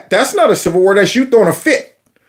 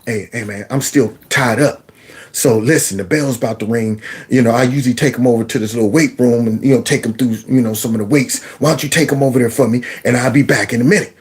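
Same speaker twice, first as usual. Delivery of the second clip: friend asking a favour; 8 semitones lower than usual. Retold or imitated. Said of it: "He comes back".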